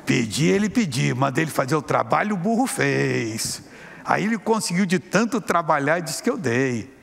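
laughing demon boast; mocking demon. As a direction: laugh at the fool who believed. Demon mocks the man.

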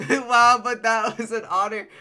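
laughing exclamation. Real, not acted. Shocked at loud sound.

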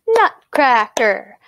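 "nutcracker" slowly in measured beats; syllable by syllable. Shown as a demonstration. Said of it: "listen very carefully to how I say those".

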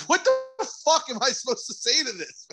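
high, baffled disbelief. Retold or imitated. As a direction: throw up your hands in confusion. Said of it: "I was like".

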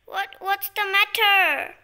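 hammed-up drawn-out question; startled woken sister. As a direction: play a sister yanked out of sleep. Woken sister answers in play.

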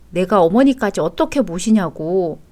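ordinary delivery

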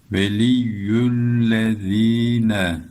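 "Veliyyüllezine" with the z said lisped, tongue between the teeth, chanted slowly; lisped letter. Shown as a demonstration. Teacher shows the lisped letter in "Veliyyüllezine", slow chant.

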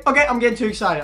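ordinary delivery

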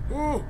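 sudden high, loud whoop; startled "ooh". Real, not acted. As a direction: yelp "ooh" in surprise. Startled while playing.

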